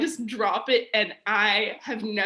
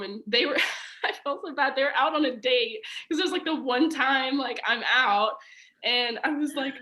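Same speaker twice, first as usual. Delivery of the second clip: normal talking voice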